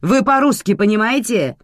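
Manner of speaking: loud, high angry challenge; irate boss losing patience; barking. A narrator's reading. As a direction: shout it with exasperation. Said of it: "рявкнула женщина".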